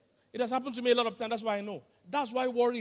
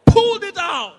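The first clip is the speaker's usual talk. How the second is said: much higher, louder shout; loud exclaimed punchline; 11 semitones higher, 10 dB louder, slower than usual. Retold or imitated. Preacher telling the congregation the nail came out, loud shout. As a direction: blurt it out as the punchline.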